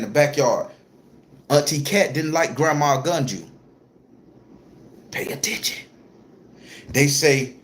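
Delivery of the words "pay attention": breathy hushed aside, Pay attention; whisper. Real, not acted. Storyteller hushes his viewers mid-ritual, whispering.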